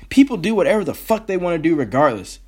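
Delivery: ordinary talk, nothing marked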